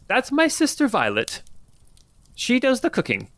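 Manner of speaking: put-on high, proud old woman; old lady introducing her sister. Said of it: "one old lady voice".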